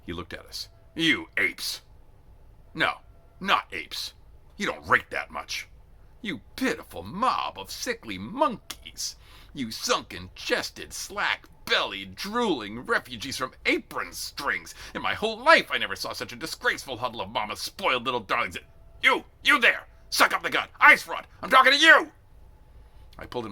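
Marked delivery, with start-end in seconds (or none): 0.9-18.6 slow, loud contemptuous insults; storming tirade. A narrator's reading. Drill sergeant insults the shivering recruits, slow and scornful. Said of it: "hearing him storm". 19.0-22.1 furious high shouting; storming yell. A narrator's reading. Sergeant yells at a recruit in the ranks, furious shout. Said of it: "hearing him storm".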